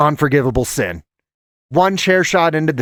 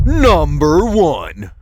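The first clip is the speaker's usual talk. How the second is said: slow, drawn-out, louder announcer reveal; dramatic countdown reveal; 3 dB louder, much slower than usual. Acted. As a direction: stretch it out like the big finale. Countdown reveal of number one.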